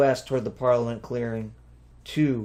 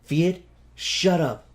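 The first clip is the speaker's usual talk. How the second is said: irritated snap; 5 semitones higher, 4 dB louder, slower than usual. A narrator's reading. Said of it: "Tu said".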